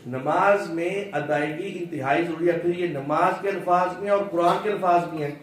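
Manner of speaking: normal talking voice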